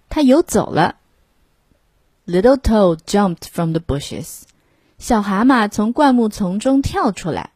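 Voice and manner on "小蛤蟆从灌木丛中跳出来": plain reading voice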